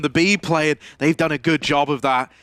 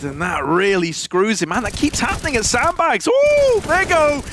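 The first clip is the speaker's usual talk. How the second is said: excited esports caster; 7 semitones higher than usual. Acted. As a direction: erupt over the big play. Caster erupts at a triple kill.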